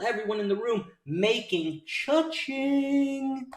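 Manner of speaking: high, ringing cheer with a long-held ending; jubilant cry. Real, not acted. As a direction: stretch the final word out like a jackpot call. Cheers the room's winnings.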